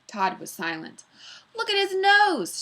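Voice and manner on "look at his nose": put-on high, loud kid's shout; boy shouts about the teacher's nose, high and loud. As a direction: yell it across the room. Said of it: "shouted Eric Bacon".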